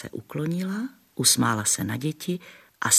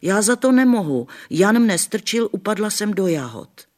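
put-on whining little girl; 10 semitones higher, 6 dB louder than usual. A narrator's reading. Adelka blaming Jan to grandma, whiny. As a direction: plead innocence like a small child. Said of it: "Adelka excusing herself".

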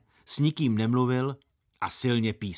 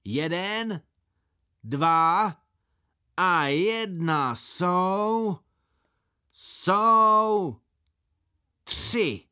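high, drawn-out counting call; called countdown; 6 semitones higher, 4 dB louder, much slower than usual. A narrator's reading. Boy counting himself off before jumping, calling out. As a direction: stretch each count out loudly, raised pitch.

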